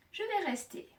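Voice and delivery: normal talking voice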